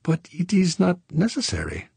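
airy protest from a Frenchman waving it away; Frenchman Saint Clair. Acted. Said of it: "Saint Clair said, waving both hands".

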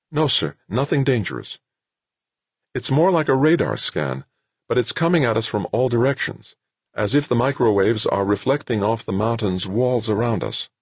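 high-pitched subordinate's report; reporting sergeant. Acted.

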